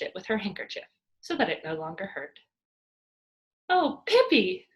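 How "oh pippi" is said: loud, high, slow excited cry. A narrator's reading. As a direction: call her name full of excitement. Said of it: "cried Annika excitedly".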